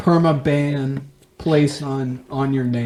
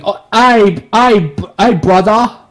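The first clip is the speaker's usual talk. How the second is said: put-on loud, high British accent; Christopher Nolan, mock British; 6 semitones higher, 6 dB louder, slower than usual. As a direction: stammer it out in hammed-up British. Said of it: "Here's my best Christopher Nolan".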